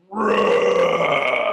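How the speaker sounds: loud drawn-out lion roar; storyteller roaring like a lion; mighty roar. Acted. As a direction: let out a huge roar. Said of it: "with a mighty roar".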